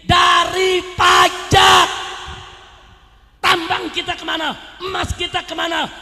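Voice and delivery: high, loud, insistent accusations; full-force indignant preaching. Real, not acted. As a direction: hurl each charge out at the crowd.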